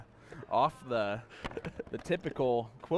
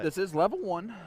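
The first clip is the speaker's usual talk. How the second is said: higher squeaky voice, louder; 4 semitones higher, 7 dB louder than usual. Pretend. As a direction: put on a squeaky little voice. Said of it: "this is a squeaky voice".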